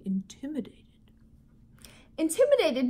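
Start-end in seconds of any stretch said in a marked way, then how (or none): 0.0-0.8 whispered word. Shown as a demonstration. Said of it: "Can everyone say that?".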